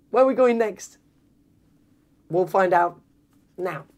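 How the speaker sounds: usual speaking voice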